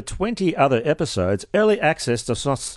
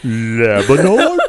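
mock puzzled; 4 semitones lower, 4 dB louder, much slower than usual. Mocking the pause.

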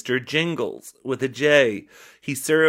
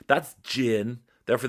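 usual speaking voice

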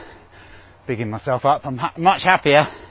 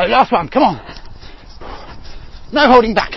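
coach shouting; 7 semitones higher, 6 dB quieter than usual. Real, not acted. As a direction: yell them through the last stretch. Coach shouting final sprint.

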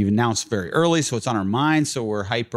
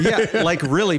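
laughing; 6 semitones higher than usual. Real, not acted. Laughs at hurting brother.

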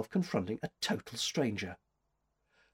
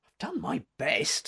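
put-on higher-pitched, discouraged girl; dispirited; 5 semitones higher than usual. A narrator's reading. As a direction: say it as if close to giving up. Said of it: "said the girl dispiritedly".

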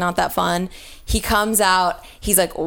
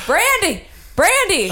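calling out; 11 semitones higher, 3 dB louder than usual. Retold or imitated. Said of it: "And he's like".

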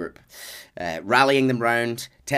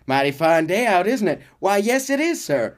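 polite cowboy small talk; 6 semitones higher, 4 dB louder than usual. Retold or imitated. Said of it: "and he goes".

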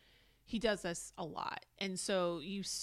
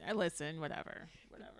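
ordinary talk, nothing marked

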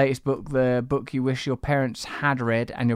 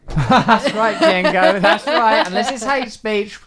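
loud, higher, laughing cheer; laughing agreement; 6 semitones higher, 6 dB louder, slower than usual. Real, not acted. Host tickled by the free speech objection, laughing loudly. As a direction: agree through a big laugh.

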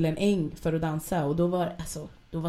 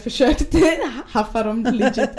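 laughing through the words, louder and higher; 6 semitones higher, 8 dB louder than usual. Real, not acted. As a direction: crack up as you admit it. Skrattar åt sin egen historia. (skrattande, högre)

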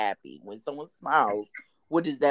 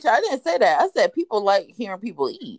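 loud protest; 8 semitones higher, 8 dB louder than usual. Real, not acted. Denying a misquote, fast and loud.

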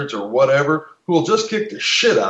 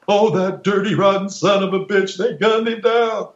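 higher, indignant mock outrage; outraged bystander; 6 semitones higher than usual. Bystander mocking the killers, indignant and raised. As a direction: sneer it like an angry onlooker. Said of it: "Most people viewed this situation with emotions".

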